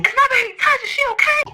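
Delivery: very high, urgent worry; imitated frantic mom. Mom on speaker asking dad, mock frantic. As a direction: play a mother beside herself.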